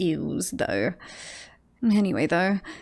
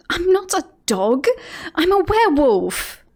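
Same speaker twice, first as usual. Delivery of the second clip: higher, louder, offended insistence; indignant protest; 8 semitones higher, 4 dB louder than usual. Acted. Werewolf objects to being called a dog, indignant. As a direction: protest loudly, voice pitched up.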